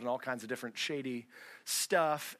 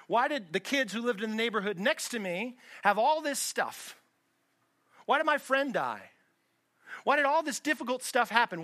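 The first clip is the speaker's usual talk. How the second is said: retold angry outburst, higher and louder; imitating furious yelling; 6 semitones higher, 5 dB louder than usual. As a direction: play a furious father demanding answers, pitched up. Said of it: "yelling at her through the rearview mirror".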